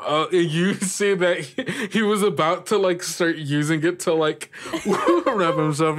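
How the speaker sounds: narrating through giggles, pitch up; laughing narration. Real, not acted. Game master laughing while describing the scarf.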